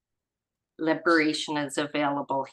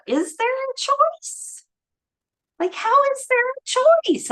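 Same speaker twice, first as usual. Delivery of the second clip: much higher, comic put-on pitch; high comic voice, playfully incredulous; squeaky mock voice; 5 dB louder than usual. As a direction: toss the question up high and silly. Rhetorical question in put-on voice.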